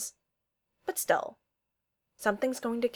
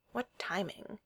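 mumbled; 9 dB quieter, slower than usual. Acted. Darcy to herself as her phone buzzes, mumbling. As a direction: mumble it under your breath. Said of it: "she mumbles".

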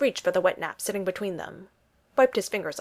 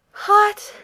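high strained yelp, mouth burning; forced out through burning heat; pained gasp; 13 semitones higher, 9 dB louder than usual. A narrator's reading. Steve gasping after a ghost pepper wing. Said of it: "Steve managed, panting a little".

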